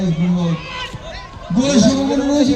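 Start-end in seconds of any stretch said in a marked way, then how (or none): 1.6-2.5 high, urgent chance call; excited commentary. Real, not acted. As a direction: shout the chance. (উত্তেজিত ধারাভাষ্য)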